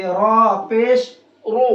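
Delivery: slow, drawn-out spelling to a full "रो"; heavy Ra. Shown as a demonstration. Teacher spelling it for students, heavy Ra. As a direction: stretch "रॉ पेश रो" with thick, rounded R. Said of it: "इसी तरह से इसको पढ़ेंगे".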